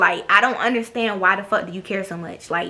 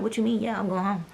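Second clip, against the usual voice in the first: ordinary talk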